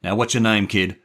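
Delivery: loud menacing demand; angry gangster. Acted. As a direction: bark it at the kid. Gangster shaking the boy.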